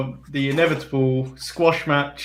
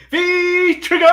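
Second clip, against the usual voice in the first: high, drawn-out commentator's yell; Kevin Kelly scream; 13 semitones higher than usual. Acted. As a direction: scream the move's name like an over-excited announcer. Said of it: "I scream like what Kevin Kelly does".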